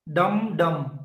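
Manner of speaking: dum, dum, both beats equally strong; equal stress demo. Shown as a demonstration. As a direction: give both beats the same weight. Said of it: "take the equal stress".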